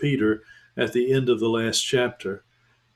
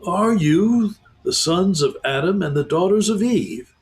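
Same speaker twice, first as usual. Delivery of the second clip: higher, cautious animal voice; a wary beaver testing strangers; 7 semitones higher, 3 dB louder than usual. Acted. Beaver character voice.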